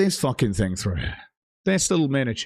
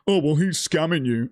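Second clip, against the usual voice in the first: mocking quote; 5 semitones higher than usual. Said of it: "I've expressed many times".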